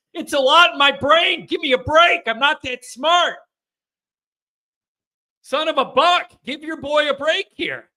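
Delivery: high, worked-up self-defense; exasperated plea. Real, not acted. Host defending himself to a complaining viewer, exasperated plea. Protests complaint about his attention.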